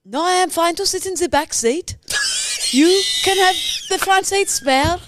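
put-on Swedish accent. A Swedish backpacker talks to a suspicious driver, Swedish accent. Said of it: "Swedish backpacker".

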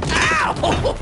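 high, hammed-up yelp of pain. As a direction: yelp as if landing hard. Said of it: "I'm like".

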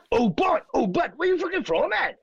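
high, exaggerated Rumney accent; mock Rumney accent, squawked high. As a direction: ham up a Rumney local, pitched right up. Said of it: "he's not from fucking Rumney".

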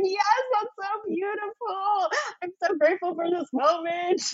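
overwhelmed grateful sobbing; crying. Retold or imitated. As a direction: choke it out through tears. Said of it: "And I'm crying at this point".

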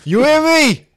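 loud, high comic holler; mock challenge. Comic calling out Harry to come out, loud mock shout. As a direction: call out your rival in a put-on shout.

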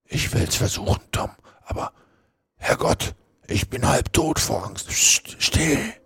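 breath-only, fearful whisper; frightened whisper. Acted. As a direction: whisper it scared, then shush urgently. Half dead with fear, hiding.

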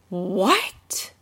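whispered, rising disbelief; incredulous. Real, not acted. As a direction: breathe out a baffled question. Reacting in disbelief.

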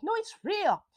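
mock-yelled comeback; retold yelling. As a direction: raise pitch and volume, imitating his protest. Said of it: "I'm not yelling too loud".

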